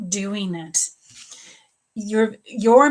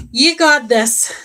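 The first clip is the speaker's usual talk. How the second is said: slow, loud encouraging shout; rally her with a big cheer; cheering a viewer on, loud and slow; 4 semitones higher, 11 dB louder, slower than usual. Real, not acted.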